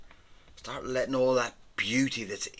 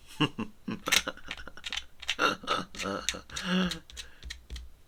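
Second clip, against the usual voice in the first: chuckling; 7 semitones higher than usual. Real, not acted. Artist amused at naming the pen Diablo, chuckling. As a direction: chuckle at your own silliness.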